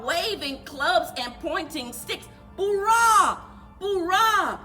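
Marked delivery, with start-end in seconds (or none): none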